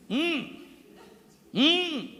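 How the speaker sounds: soft high-pitched mm hums; high mock hums. A preacher showing how Jesus did not answer, high soft hums. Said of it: "Jesús no le dijo".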